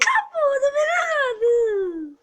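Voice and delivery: laughing. Real, not acted. Still laughing after evil laugh.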